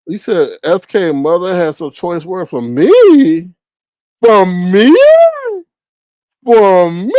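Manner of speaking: loud, offended for me; indignant. Real, not acted. Streamer offended that someone has words for her, loud indignant.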